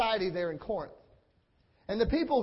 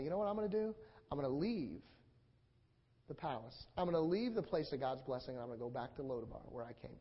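sinking to a soft, low mutter of giving up; a believer softly deciding to leave the palace, resigned and quiet; quiet resigned quitting; 9 semitones lower, 11 dB quieter than usual. Retold or imitated. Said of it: "They say".

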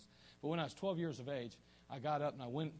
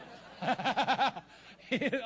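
loud, high burst of laughter; big laugh; 9 semitones higher, 8 dB louder than usual. Real, not acted. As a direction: crack up loudly at your own joke. Laughs at own slipped joke.

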